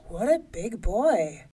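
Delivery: loud, slow amazement; amazed giant. A narrator's reading. Giant peering into the cradle, amazed. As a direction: marvel loudly, stretching the words. Giant marvels at huge baby.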